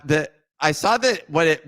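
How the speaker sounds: ordinary talk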